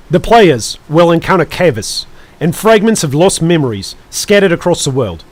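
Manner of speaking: put-on New Zealand announcer accent; amateur New Zealand accent, punchy phrasing. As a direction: read it out in a mock Kiwi accent. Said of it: "I need my Australian accent ... New Zealand".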